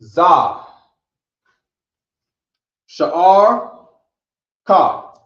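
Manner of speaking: "Za", "Sha'ar", "Ka" each called out loud and apart; teacher calls the sounds loudly one at a time; slow drill. Shown as a demonstration. Teacher drilling the student on each word, loud. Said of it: "Right, ready?".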